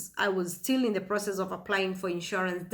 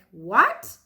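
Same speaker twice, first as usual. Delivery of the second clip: louder, disbelieving what; stunned; 6 dB louder than usual. Retold or imitated. Mother told her baby has died, stunned disbelief. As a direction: hit with news she can't take in. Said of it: "I froze".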